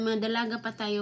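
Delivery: ordinary talk, nothing marked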